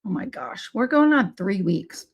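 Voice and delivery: ordinary talk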